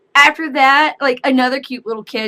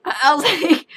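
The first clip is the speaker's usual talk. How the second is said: ordinary talk, nothing marked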